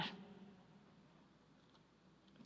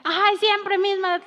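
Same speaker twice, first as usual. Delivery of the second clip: higher, louder complaining husband voice; grumpy husband; 6 semitones higher, 8 dB louder than usual. Retold or imitated. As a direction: gripe at the soup like a spoiled husband. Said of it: "llega y está la esposa".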